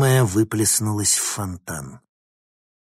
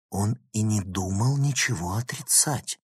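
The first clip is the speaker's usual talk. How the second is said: plain reading voice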